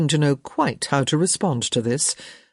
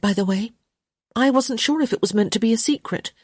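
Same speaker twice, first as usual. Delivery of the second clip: lightly voiced higher-pitched young lady; 6 semitones higher than usual. A narrator's reading. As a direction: explain yourself in a lightly raised lady's voice.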